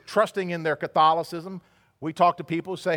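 ordinary talk

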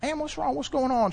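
high and soft, gently asking; kind concern. Retold or imitated. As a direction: coax a worried lady kindly, voice lifted and hushed. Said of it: "in his kind way that he is".

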